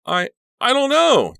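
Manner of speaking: high, loud stammered wail; distressed stammer. A narrator's reading. Danielle answers with blood on her chin, stammering. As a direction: stumble on the first word, then cry out. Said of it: "Danielle stammered".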